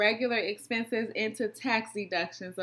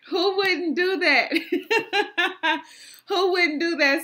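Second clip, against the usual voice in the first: laughing; 7 semitones higher, 4 dB louder than usual. Real, not acted. Laughing at her own pitch.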